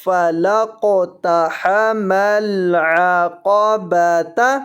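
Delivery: slow recitation ending on a voiced "تا"; joined reading. Shown as a demonstration. Teacher showing how to read on, slow chant with "تا" pronounced. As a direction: keep the final "تا" sounded, each syllable measured. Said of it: "آگے سے ملا کر پڑھنا ہے تو".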